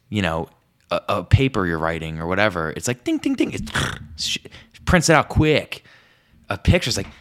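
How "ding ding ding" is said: printer ding. Retold or imitated. Imitating printer sound.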